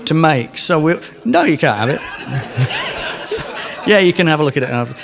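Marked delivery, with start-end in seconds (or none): 2.0-3.8 a real high-pitched burst of laughter. Real, not acted. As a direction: laugh out, teasing the crowd. Teasing audience, can't have it.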